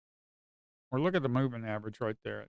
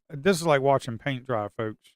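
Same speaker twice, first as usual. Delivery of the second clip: usual speaking voice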